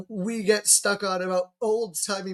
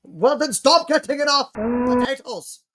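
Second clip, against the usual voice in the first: put-on Scottish accent; 6 semitones higher, 5 dB louder than usual. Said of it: "No, it's my Scottish".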